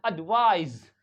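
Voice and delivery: "एडवाइज" with a voiced z at the end. Shown as a demonstration. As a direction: stress 'vaiz' in "एडवाइज", loud. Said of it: "it becomes Z".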